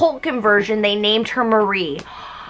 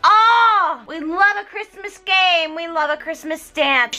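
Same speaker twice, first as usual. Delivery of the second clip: hammed-up delight at the games; gushing party guests; 5 semitones higher than usual. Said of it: "everybody's like".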